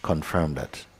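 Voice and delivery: soft, unhurried meditation guidance with long pauses; guided meditation. Acted. Teacher guiding students to rest as awareness, softly. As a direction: guide the listeners inward, quietly.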